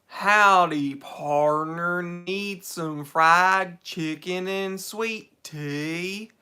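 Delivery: slow, loud, hammed-up cowboy Southern American accent; mock Southern American accent. Mocking how Brits imitate Americans: slow loud cowboy Southern American accent. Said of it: "speak like an American".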